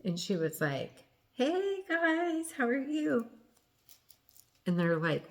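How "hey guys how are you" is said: much higher, sing-song innocent mom; sweet fake greeting. Drunk mother greeting the EMTs, high and innocently cheerful. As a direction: chirp it like nothing happened.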